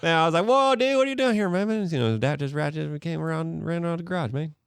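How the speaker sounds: put-on Southern Ohio accent, rising high on the surprise. Luke doing Southern Ohio for his friends, a Southern Ohio accent. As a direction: tell the rat story like a Southern Ohio kid. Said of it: "[name] doing Southern Ohio".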